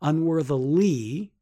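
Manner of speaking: careful "Unworthily" with the ending stretched; stress on the ending. Shown as a demonstration. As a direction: lean deliberately on the "-ly" of "Unworthily". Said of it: "that's why I keep saying it like that".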